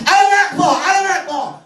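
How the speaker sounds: high shout of the name; a frustrated traveller yells his name at the counter, loud and high; losing it, shouting. Retold or imitated. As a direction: yell it in frustration. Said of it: "I just lost it".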